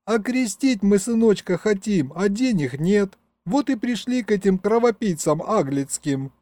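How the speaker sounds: put-on high-pitched plaintive girl's voice; tearful young woman. A narrator's reading. Young mother explains why they came for money, high and tearful. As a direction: pour out the trouble, acted tearful. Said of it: "всхлипнула девушка".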